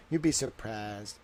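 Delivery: ordinary talk, nothing marked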